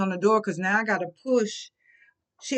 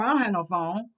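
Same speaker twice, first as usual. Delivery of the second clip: ordinary delivery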